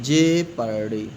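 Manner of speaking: ordinary talk, nothing marked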